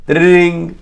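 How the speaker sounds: high, ringing "ding" with a held tone; imitated bell ring. Storyteller imitates the bell, ringing. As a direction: mimic a bell, loud and sustained. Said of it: "he rings it".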